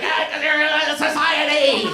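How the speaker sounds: slow, high, hammed-up shout; retold argument, slow mock shout. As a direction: drag out a dumb comeback. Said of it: "I was like".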